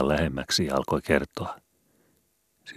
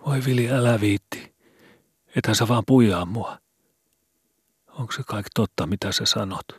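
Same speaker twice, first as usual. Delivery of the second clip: put-on lightly raised, pleading boy's voice; scared boy Skobe; 7 semitones higher than usual. A narrator's reading. Skobe pleads with Vili in a frightened boy's voice. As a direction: voice a frightened kid begging his friend.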